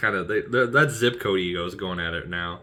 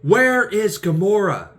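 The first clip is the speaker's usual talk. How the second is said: threatening demand; 14 semitones higher, 7 dB louder than usual. Retold or imitated. Drax-style demand where Gamora is, higher and louder. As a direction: demand an answer once only. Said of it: "He's like".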